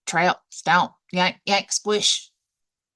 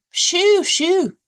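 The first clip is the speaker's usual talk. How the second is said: high, loud shoo; shooing pigs; 12 semitones higher, 7 dB louder than usual. Acted. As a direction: chase the pigs off like a fussy hen. Hens chasing pigs away.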